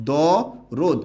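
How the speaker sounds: stretched দ, pause, রোদ with a rounded o; syllable-by-syllable pronunciation. Shown as a demonstration. Teacher confirming the word for students, syllable by syllable. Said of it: "উচ্চারণটা কি?".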